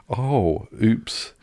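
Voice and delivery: ordinary talk, nothing marked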